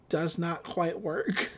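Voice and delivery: ordinary delivery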